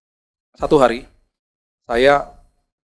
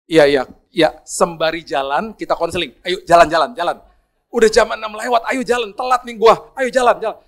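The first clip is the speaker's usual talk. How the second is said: higher, louder impatient urging; urgent hurrying; 5 semitones higher, 4 dB louder than usual. Retold or imitated. Preacher urging the crying driver to drive on, higher and louder. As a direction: push him to drive, you're late.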